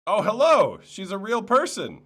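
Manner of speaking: very loud, high-pitched exclamation; over-the-top delighted surprise; mock astonishment. Real, not acted. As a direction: shoot way up in pitch and volume, amazed. Mock astonishment at her greeting.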